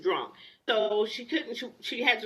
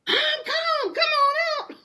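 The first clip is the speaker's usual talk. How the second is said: very high, louder crying yell; high soprano yell; 5 dB louder than usual. Retold or imitated. Wife shrieking at husband behind the locked door. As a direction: shriek that you're calm when you're not. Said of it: "I'm up here in high soprano".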